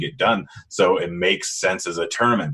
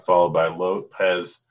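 ordinary talk, nothing marked

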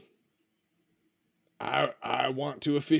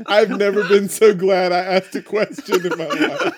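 laughing through the words; can't stop laughing; 2 semitones higher, 7 dB louder than usual. Real, not acted. Laughing at the reply.

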